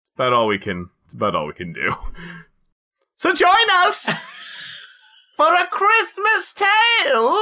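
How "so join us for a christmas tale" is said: very high falsetto, drawn-out announcement; hammed-up squeaky storyteller; mock theatrical invitation.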